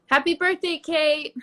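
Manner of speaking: sudden high, loud birthday shout; yelled. Real, not acted. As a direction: blurt the greeting out loud. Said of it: "I don't know why I yelled that".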